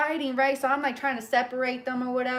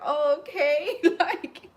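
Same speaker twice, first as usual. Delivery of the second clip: relieved laugh; 4 semitones higher, slower than usual. Real, not acted. Relieved after praise.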